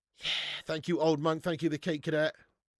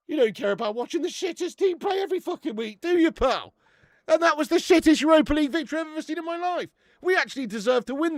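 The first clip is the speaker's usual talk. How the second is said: much higher and louder, jeering; loud mocking rant; 13 semitones higher, 9 dB louder than usual. Real, not acted. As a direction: rant at the rival fan at full volume. Ranting at Spurs fan.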